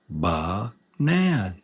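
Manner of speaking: Banana split into three slow, separate beats; syllable by syllable. Shown as a demonstration. Teacher showing learners three vowel sounds, Banana said syllable by syllable. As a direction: say Banana one beat per syllable. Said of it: "three beats".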